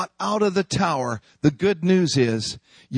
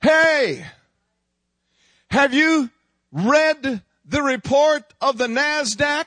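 devil taunt; 7 semitones higher than usual. Acted.

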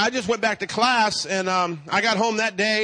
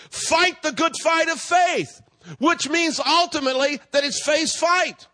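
forceful, high-pitched sermon delivery; raised, declaring preacher's voice; preaching; 4 semitones higher, 3 dB louder than usual. Real, not acted. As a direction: preach it out with emphatic phrasing to the congregation. Preaching after telling a story.

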